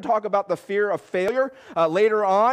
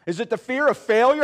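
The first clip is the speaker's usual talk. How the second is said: ordinary delivery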